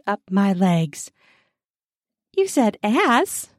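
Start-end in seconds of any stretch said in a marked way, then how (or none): 2.4-3.5 playful sing-song tease of You said ass; teasing. Acted. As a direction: tease her lightly, higher and slower. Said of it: "I tease".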